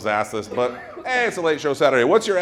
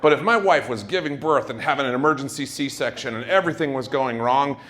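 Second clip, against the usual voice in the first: usual speaking voice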